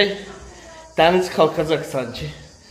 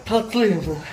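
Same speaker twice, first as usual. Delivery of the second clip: ordinary delivery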